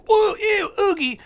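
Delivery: hammed-up disgust, way up in pitch; disgusted dad. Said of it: "he's like".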